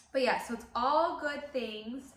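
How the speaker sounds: slow, wavering through real tears; trying to sound fine through tears; teary, still crying. Real, not acted. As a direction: push the words out while still crying. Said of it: "Hang on, let me get myself together".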